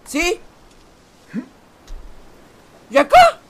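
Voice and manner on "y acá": high, loud, alarmed question; panicked question. Real, not acted. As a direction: ask in a rising panic. Panicked while climbing.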